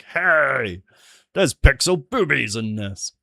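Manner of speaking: loud, indignant mock call-out; mock outraged reviewer. Streamer imagining a YouTube reviewer, mock outrage. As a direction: imitate a scandalised reviewer raising the alarm.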